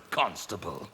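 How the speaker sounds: ordinary talk, nothing marked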